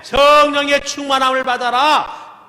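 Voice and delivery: high, loud proclamation; full-force preaching. Acted. Pastor proclaiming to the congregation at full force. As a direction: proclaim it to the whole church.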